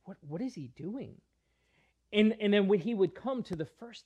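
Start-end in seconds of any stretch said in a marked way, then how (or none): 0.0-1.2 retold whisper. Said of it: "whispering amongst themselves".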